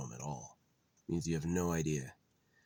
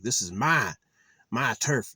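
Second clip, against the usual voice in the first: higher, louder mock possessive voice; mock territorial claim; 6 semitones higher, 8 dB louder than usual. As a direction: claim your turf like a petty landowner. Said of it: "is like".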